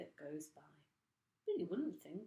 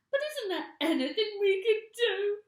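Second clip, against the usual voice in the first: very high, loud sobbing plea; tearful cry; 14 dB louder than usual. A narrator's reading. Mrs Twit begging her husband in a high tearful voice. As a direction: beg through tears. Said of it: "cried Missus Twit".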